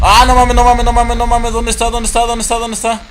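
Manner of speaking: high, rapid-fire panicked repeating. Real, not acted. Player loses sight of the creature, panicked.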